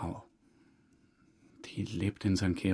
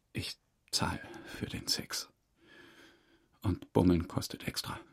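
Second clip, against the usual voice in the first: ashamed whisper; 3 semitones lower, 5 dB quieter than usual. Acted. Admits paying a prostitute.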